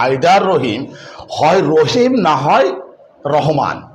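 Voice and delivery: ordinary talk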